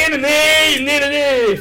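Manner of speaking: high loud na-na-na buzz; buzzing noise. Pretend. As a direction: imitate an electric buzz. Mimicking shocker toy buzz.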